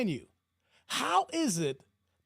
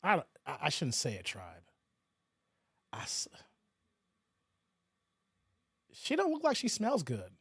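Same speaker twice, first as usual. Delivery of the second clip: dropping to a near whisper; hushed aside; 9 semitones lower, 6 dB quieter than usual. Real, not acted. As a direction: lean in and say it quietly. Host drops to hushed aside.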